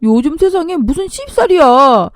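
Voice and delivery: loud, high-pitched scolding son; angry reproach. Acted. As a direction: scold your mother in exasperation. Son scolds mother in quarrel. (화내며 나무라는 말투)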